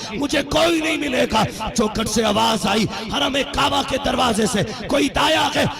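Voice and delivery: high, impassioned sermon storytelling with a sung lilt; preaching at full force. Acted. Preacher telling the congregation of Halima, at full force. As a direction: preach the tale, rising and half-singing.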